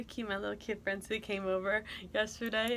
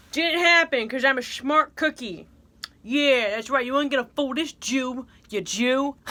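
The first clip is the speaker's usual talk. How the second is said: loud boast; 4 semitones higher, 10 dB louder than usual. Acted.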